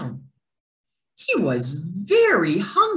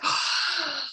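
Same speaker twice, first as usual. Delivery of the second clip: big inhale; 9 semitones higher, 4 dB quieter than usual. A narrator's reading. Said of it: "took an enormous breath".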